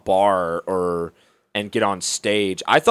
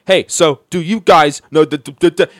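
loud, higher mock reading off a notepad; stupid script-reading; 4 semitones higher, 8 dB louder than usual. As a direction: imitate a bad comic reading jokes word by word. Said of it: "just read right off and say".

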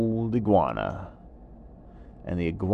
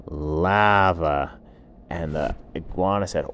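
ordinary delivery

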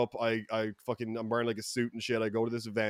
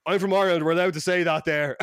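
brazen excuse; 5 semitones higher, 6 dB louder than usual. Retold or imitated. Acted brazen excuse to shocked Americans.

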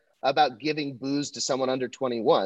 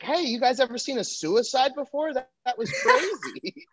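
higher, slow deadpan comic; mock comic; 10 semitones higher than usual. Comic after a suicide onstage, high and slowed. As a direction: play it high and dazed. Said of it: "You're like".

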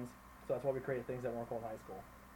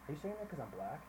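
softer, deadpan mock accusation; straight-faced challenge; 6 dB quieter than usual. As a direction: put it to the coach with a straight face. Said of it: "Tony's straight face looked Coach Bomo".

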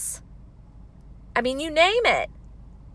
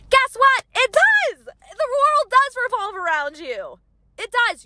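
fervent, rising declaration; passionate outburst; 10 semitones higher than usual. Real, not acted. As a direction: exclaim it with full conviction. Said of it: "I get really passionate".

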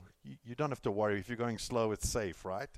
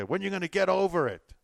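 high, loud mock exasperation; impatient demand; 10 semitones higher, 9 dB louder than usual. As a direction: imitate a pushy, fed-up critic loudly. Mimics impatient people telling mourners off.

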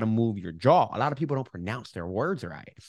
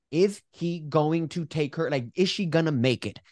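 anxious suspense; 6 semitones higher than usual. Retold or imitated. Retold suspense while watching movie.

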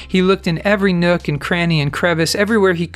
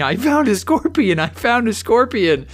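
retold excited shouting; 6 semitones higher than usual. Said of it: "He was shouting and screaming".

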